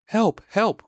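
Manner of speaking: high, loud, frightened cries; Piglet crying for help. A narrator's reading. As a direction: shriek for help like a terrified little pig. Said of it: "cried Piglet".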